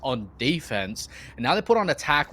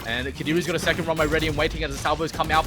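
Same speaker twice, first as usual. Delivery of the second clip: normal talking voice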